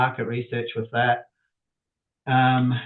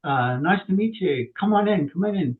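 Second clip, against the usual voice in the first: higher-pitched, lightly imitated hostess greeting; welcoming lady customer; 7 semitones higher than usual. Lady customer greeting the plumber at her door, imitated welcoming voice. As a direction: put on a lady's higher voice.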